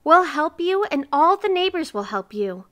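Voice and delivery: high, loud, ringing promise; insistent reassurance. A narrator's reading. Neighbors promise help to miser, insistent. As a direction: proclaim it to win him over.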